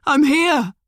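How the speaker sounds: loud, high desperate shout. A narrator's reading. Liam shouting to Emily on mute, desperate. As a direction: yell it out. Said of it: "he shouted".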